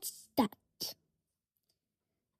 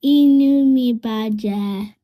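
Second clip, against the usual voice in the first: sad model; 3 semitones lower, 10 dB louder than usual. Shown as a demonstration. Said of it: "Can you say it with me".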